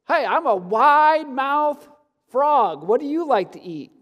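big, high, loud, stretched frog voice; wide-mouthed frog. Acted. Voicing frog in a joke.

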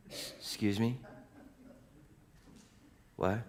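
soft questions with a long gap; stunned reaction. Acted. Preacher as shocked rabbis, hushed disbelief. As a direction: ask quietly in disbelief.

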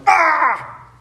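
high sharp demonic grunt; a sudden startled demon cry; demon's grunt as Jesus preaches. Shown as a demonstration. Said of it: "it's kind of like a grunt".

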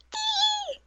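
very high squeal; squeal of joy. Retold or imitated. Contrasting Yui's despair with sudden joy, a very high squeal. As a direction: squeak it like pure delight.

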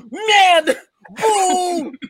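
high mock old lady boo; old woman booing. Old French woman booing him.

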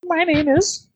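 very high, polite chicken voice; a chicken delighted to be asked its name; talking chicken voice. Acted. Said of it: "Why am I using this voice?".

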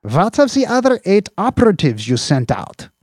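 high, loud, pushy boss voice; a boss grilling his subordinate; Slope, demanding boss. Acted. Police chief grilling an officer over missing agents, demanding.

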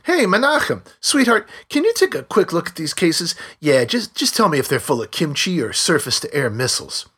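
roaring sarcasm. A narrator's reading. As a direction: ham up calling to the husband, sarcastic.

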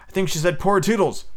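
plain reading voice

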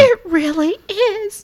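very high, slow, squeaky voice; Lexi. Acted. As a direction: agree in a wounded squeaky voice. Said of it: "it's an emotional thing".

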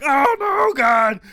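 high, loud hammed-up panic; mock terrified cry. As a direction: Zorro squealing as they grab him. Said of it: "Zorro's like".

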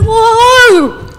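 high, loud panicked whoa; freaking out. Pretend. Preacher as Mary seeing the angel, loud and panicked. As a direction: burst out in mock panic. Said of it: "I'm like".

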